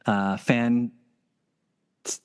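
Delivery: usual speaking voice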